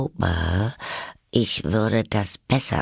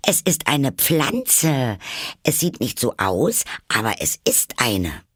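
put-on eager girl insisting, higher; 5 semitones higher than usual. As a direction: insist brightly on it. Alice eager, high.

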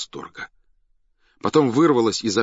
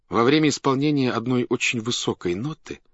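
ordinary delivery, nothing marked

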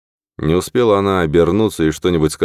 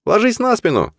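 high shouted order; shouting. Acted. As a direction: yell it over the rushing water. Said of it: "крикнул Норман".

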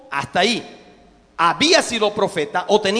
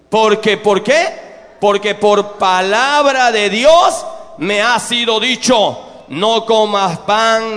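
slow, loud, every word hammered; emphatic preaching; 5 dB louder, much slower than usual. Acted. Preacher drilling God's command into the congregation, slow and loud. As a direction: drive the command home.

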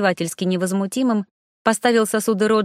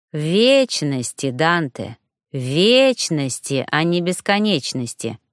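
ВЕЧНОСТИ drawn out very slowly and lower, both times; pointed correction; 4 semitones lower, slower than usual. A narrator's reading. Ralph correcting Dante's theory, pointedly stressing вечности. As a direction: stretch the first vowel of вечности, stressing it against бесконечности. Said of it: "поправил его Ральф".

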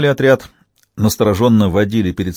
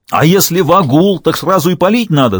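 loud, high-pitched scolding; angry yelling; 7 semitones higher, 4 dB louder than usual. A narrator's reading. Commander scolding soldier for shooting, angry yell. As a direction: tear into him with indignant force. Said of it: "заорал на него сотник".

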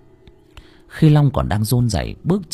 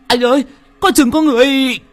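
much higher, loud panicked yell; shouted warning; 5 dB louder than usual. A narrator's reading. Young assistant yelling a warning to his boss, panicked shout. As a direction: shout the warning while grabbing the wheel. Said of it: "vừa đánh lái vừa hét".

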